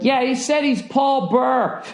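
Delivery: high, drawn-out imitation of the lady; mock parking attendant. Parking lady relaying the comedians' name, high mocking imitation.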